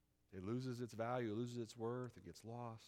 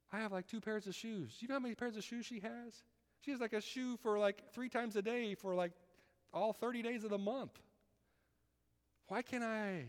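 whiny complaint; 10 semitones higher than usual. Retold or imitated. Mocking envious complaint, high voice.

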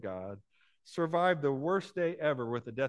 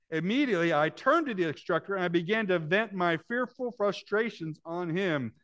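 loud, higher, driven preaching read; dramatic reading; 7 semitones higher, 7 dB louder than usual. Acted. Preacher reading a pilot's story to the congregation, loud and urgent. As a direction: push the story out with rising urgency.

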